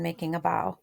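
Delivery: ordinary talk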